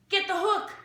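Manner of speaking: high, loud heckling yell. A narrator's reading. Another spectator yelling, high and loud. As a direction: shout it from the crowd. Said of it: "yelled somebody else".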